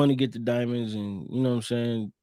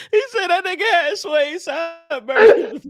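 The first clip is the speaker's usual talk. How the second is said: high, slow laughing; helpless laughter at the line; 6 dB louder than usual. Real, not acted. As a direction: wheeze it out through laughter. Laughing disbelief.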